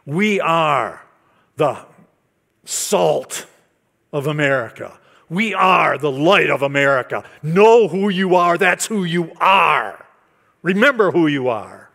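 loud, high preaching cadence. Retold or imitated. Retelling the guest pastor's sermon, loud preaching. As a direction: proclaim it to the congregation. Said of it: "And he had to say".

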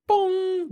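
loud, high ringing imitated gong; machine bong. A narrator's reading. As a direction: sound a high alarm chime with the voice. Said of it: "went the Monitex".

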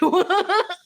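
short burst of high laughter. Real, not acted. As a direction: laugh out loud. Laughs after her own joke.

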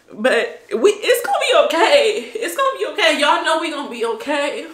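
high, loud laughter through the words; laughing it off. Real, not acted. Vlogger laughing to her fans after crying, relieved laughter. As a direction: laugh while reassuring yourself.

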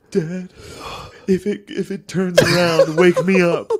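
high, slow, whimpering plea to Dad; mock tearful plea. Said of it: "and I cried".

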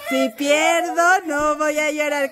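slow pledge broken by laughter; can barely say "pierdo" for giggling; laughing through the pledge. Shown as a demonstration. Mother repeats the pledge to her kid, laughing. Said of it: "repite lo que dijimos".

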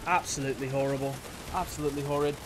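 put-on English accent. Real, not acted. Gamer bemoaning own horrid aim, English accent. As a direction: slip into a mock English accent. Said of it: "I don't know why I start speaking in, like, an English accent".

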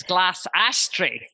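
Scottish accent with a short flat a in "glass" and "ashtray". Shown as a demonstration. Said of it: "But when you're Scottish".

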